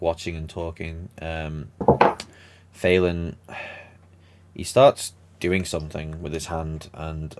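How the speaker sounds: ordinary talk, nothing marked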